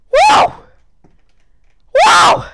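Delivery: high, loud whooping cheer; excited whoop. Real, not acted. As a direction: whoop with triumph. Cheering after second try.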